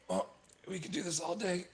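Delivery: high, strained bluff at attackers; put-on high-pitched bravado; wounded bravado. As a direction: act defiant while hurt, pitch well up. Wounded character taunts his attackers.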